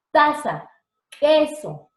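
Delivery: Taza and queso, high and loud; crisp t in Taza, crisp k in queso; demo words. Shown as a demonstration. Teacher demonstrates voiceless t and k to students. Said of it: "¿se dan cuenta?".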